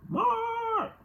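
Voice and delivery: mock mom yell in high falsetto; mother screaming out. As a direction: imitate mom calling out, very high-pitched. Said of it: "she would scream out".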